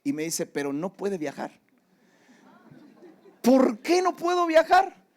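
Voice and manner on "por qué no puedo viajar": loud, high, slow protest; a much louder, higher cry of frustration; exasperated outcry. Retold or imitated. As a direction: stretch it out in loud disbelief. Pastor reacts to clerk's refusal.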